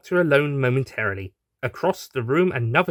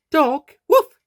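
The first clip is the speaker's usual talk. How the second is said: high, louder mock bark; playful woof; 8 dB louder than usual. Doctor listing animals, high playful woof.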